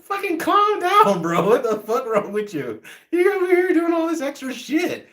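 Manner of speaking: high-pitched incredulous protest; acted-out high-pitched exasperation; exasperated comeback. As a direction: plead in a high, strained voice for sanity. Acts out his exasperated comeback.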